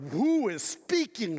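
a mildly booming, awed question. Retold or imitated. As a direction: imitate a big booming voice asking who speaks. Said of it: "he had that kind of booming voice".

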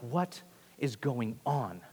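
slow, hushed disbelief; low and slow, quietly baffled; bewildered wondering. Retold or imitated. Peter walking away from the empty tomb, quietly bewildered. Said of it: "Peter walked away wondering".

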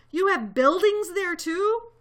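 high, loud amazement; astonished gasp. A narrator's reading. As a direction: gasp it out in disbelief. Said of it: "Horton gasped".

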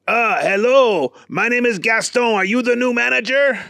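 friendly French chef; lightly voiced chef; Gaston voice. A narrator's reading. Gaston greets Booger.

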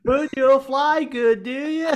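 high, drawn-out hammy impression; Littlefinger, overacting. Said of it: "overacting so much".

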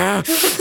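short seething breath; fuming huff. Retold or imitated. Driver stared at by his partner, fuming huff. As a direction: puff with mock rage. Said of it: "just, like, fuming".

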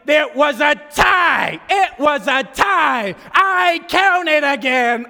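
high, loud, slow emphatic shout; triumphant, hammed-up yelling; excited announcement. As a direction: shout the big news, stretching each word. Teammates announce the tie excitedly.

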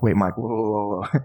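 taken aback. Acted. Acting out a confused reaction.

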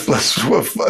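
ordinary delivery

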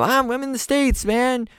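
high drawling rocker voice. Retold or imitated.

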